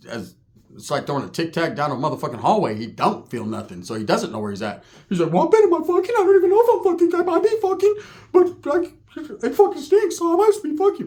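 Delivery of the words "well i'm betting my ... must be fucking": very high squeaky impression; cartoonishly high man's voice; Ricky's high-pitched voice. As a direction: push it way up into falsetto. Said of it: "He has that high-pitched voice".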